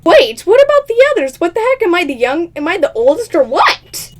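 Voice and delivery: usual speaking voice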